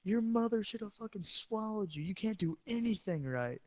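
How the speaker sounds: high, put-on mock rage voice; a fake angry rant at a classmate, played for laughs; mock furious insult. Teen jokingly insulting his friend in class, mock angry. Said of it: "I was doing it jokingly".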